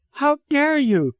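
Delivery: slow, high-pitched indignation; angry cry. A narrator's reading. Widow rebukes doctor for his declaration, angry cry. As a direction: rebuke him, high and drawn out. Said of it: "she cried angrily".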